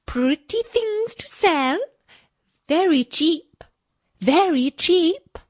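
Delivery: put-on high, calling old peddler woman; peddler woman's call. A narrator's reading. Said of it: "and cried".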